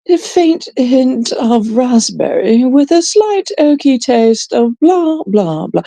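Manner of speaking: high, drawn-out wine-snob recital; mock flyer reading. Mocking her reading a flyer of wines, singsong recital. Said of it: "she's reading a flyer".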